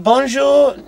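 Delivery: high, loud attempt at French; best French. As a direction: call out a cheery French greeting in an English trucker's best French. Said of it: "speaking in your best French".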